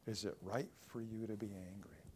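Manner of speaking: a hushed, searching rebuke; quiet. Shown as a demonstration. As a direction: lay the question gently and low. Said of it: "remember that verse".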